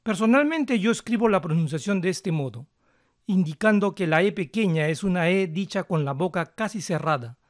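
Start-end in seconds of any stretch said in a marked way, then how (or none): none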